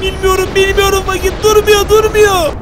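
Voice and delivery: frantic gamer panicking; frantic panic. Real, not acted. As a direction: beg it to stop. Panic continues.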